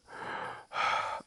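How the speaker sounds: big acted breath. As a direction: heave a big relieved breath. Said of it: "And Jordan was like".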